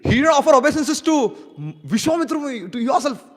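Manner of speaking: high, loud disbelief; outraged surprise. Acted. Lord Ram hearing of the snub, high and outraged. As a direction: burst out in shocked outrage. Ram shocked at Vishwamitra's report.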